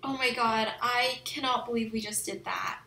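normal talking voice

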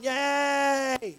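high, drawn-out yay; hammed-up cheer of the overexcited believer; mock excited cheering. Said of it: "got all excited about the promise".

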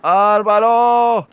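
grito largo y agudo, estirando el nombre; llámalo a gritos desde lejos. Real, not acted. Llama al compañero caído. (grito alargado)

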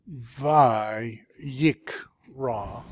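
"Vi, yik, ra" with a break after each; syllable by syllable. Shown as a demonstration. Teacher sounding out the word for students, "Vi, yik, ra" split. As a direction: sound out "Vi, yik, ra" in three pieces. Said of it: "then you start over".

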